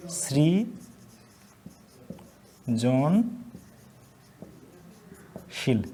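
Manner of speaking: dictating সৃজনশীল to students; slow dictation. Shown as a demonstration. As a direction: draw out সৃজনশীল gently. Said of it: "dictating word slowly".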